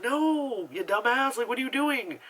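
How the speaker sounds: high-pitched, incredulous telling-off; exasperated scolding. Acted. Reviewer tells off the film's friend, exasperated scolding. Mock scolding of film character.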